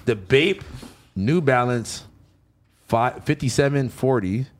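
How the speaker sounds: low steady release announcement; announcer read. Acted. Reads release details.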